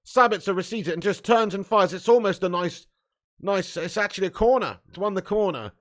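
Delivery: ordinary talk, nothing marked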